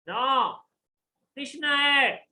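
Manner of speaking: put-on loud, high protest of a wrongly accused boy; insistent child. Son protests when accused of lying.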